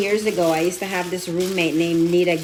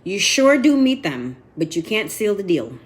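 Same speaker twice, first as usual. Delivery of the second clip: Southern drawl; 5 dB louder than usual. Retold or imitated. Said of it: "she says".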